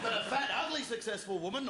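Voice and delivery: ordinary talk, nothing marked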